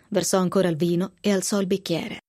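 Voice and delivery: plain reading voice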